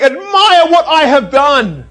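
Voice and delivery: loud, high, slow proclamation; mock grand boast. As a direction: proclaim it big, hungry for admiration. Voicing the decorations' boast.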